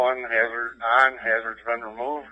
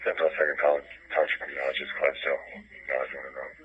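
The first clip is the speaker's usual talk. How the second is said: terse radio update; 4 semitones lower than usual. Real, not acted. Township unit radioing dispatch.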